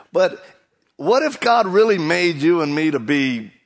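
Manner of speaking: loud, rising sermon voice; preaching at full force. Acted. Preacher urging the congregation, loud and rising. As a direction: press the point home to the crowd.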